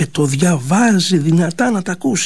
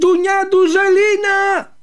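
high, drawn-out joyful calling; a long, high, sung-out call; slower than usual. A narrator's reading. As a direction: call her name across the plain from far away.

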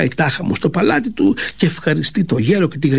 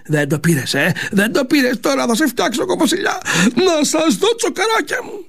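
rising, higher and higher mock threat; old man's threat; 10 semitones higher than usual. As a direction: gloat at the king in a squeaky old voice. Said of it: "φωνάζει ο γέρος". (ο γέρος απειλεί τον βασιλιά, όλο και πιο ψηλά)

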